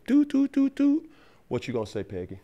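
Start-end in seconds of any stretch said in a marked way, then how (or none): 0.1-1.1 high sung beeps; dial tone. Retold or imitated. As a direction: imitate a hang-up tone. Imitating hang-up tone.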